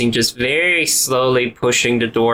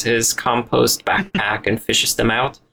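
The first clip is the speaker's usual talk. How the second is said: ordinary talk, nothing marked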